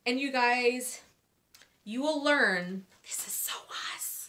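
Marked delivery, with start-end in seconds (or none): 3.0-4.3 whispered aside. Real, not acted. Confiding aside.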